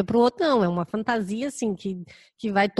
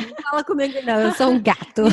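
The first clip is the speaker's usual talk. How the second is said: laughing mock brush-off; 3 semitones higher than usual. Friend mocks her cold brush-off.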